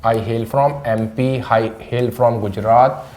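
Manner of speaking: very slow, each word laid out; slow model sentence. Shown as a demonstration. Teacher models the sentence slowly for students to repeat. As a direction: say it slowly for learners to copy. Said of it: "तो आप बोल सकते हो".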